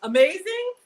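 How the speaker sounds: high, loud "amazing"; amazed. Real, not acted. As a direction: burst out in delight. Reacting to the loaf.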